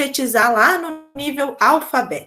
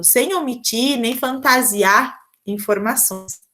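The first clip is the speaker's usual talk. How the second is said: normal talking voice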